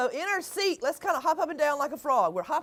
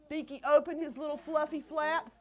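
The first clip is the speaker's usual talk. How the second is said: usual speaking voice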